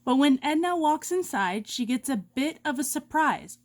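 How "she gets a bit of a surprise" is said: ordinary delivery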